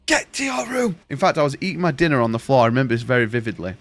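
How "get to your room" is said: high-pitched mock scolding; retold angry mum. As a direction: imitate a mum ordering a child off. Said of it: "my mum was like".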